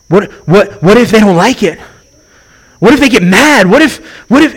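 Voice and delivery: stammered what-ifs climbing high; mock fretting, rising in pitch; acted mounting worry. Preacher voicing our excuses to God, mounting worry. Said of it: "And we go".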